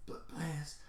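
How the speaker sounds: very soft, halting last words; acted failing breath, stammering quietly; dying hero. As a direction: struggle to get each word out. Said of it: "on the last leg of his life".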